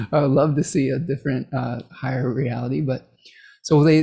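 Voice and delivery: ordinary talk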